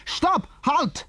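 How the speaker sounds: very high, sharp halt order; shouted command. A narrator's reading. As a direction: call out commands from a distance. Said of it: "a loud voice shouted to them".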